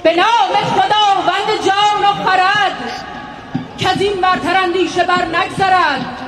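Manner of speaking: loud, rhythmic chant of epic verse; epic teahouse storyteller. Acted. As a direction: declaim the verses like a bard. (نقالی حماسی با صدای بلند)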